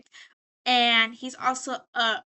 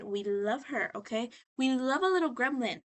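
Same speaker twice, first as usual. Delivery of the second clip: usual speaking voice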